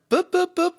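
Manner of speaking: high-pitched beeping noises; keypad beeps. Retold or imitated. Customer showing the PIN entry, high beep noises.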